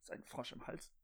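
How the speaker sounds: talking around a sip; hoarse, froggy throat, drinking; while sipping a drink. Real, not acted. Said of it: "einen Schluck trinken".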